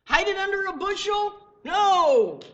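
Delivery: high, loud question, then a shouted no; emphatic rhetorical outcry. Real, not acted. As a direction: cry it out to the congregation, high and forceful. Preacher rhetorically asks, answers emphatically.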